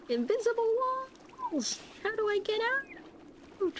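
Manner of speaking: high, slow little creature voice; panicky pet voice. Acted. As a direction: voice a trapped tiny pet. Voicing the panicking axolotl.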